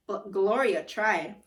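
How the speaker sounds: plain reading voice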